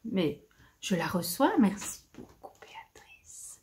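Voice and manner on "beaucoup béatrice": soft whispered thanks; whispering. Real, not acted. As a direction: breathe the thanks tenderly. Touched thanks to the giver.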